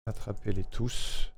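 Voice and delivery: soft, slowed try of a catchphrase; slogan run-through. Real, not acted. Streamer rehearsing a jingle line, soft and slow. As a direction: say it slowly and softly, testing the rhythm.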